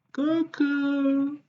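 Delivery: playful hello. Acted. Playful greeting to viewers.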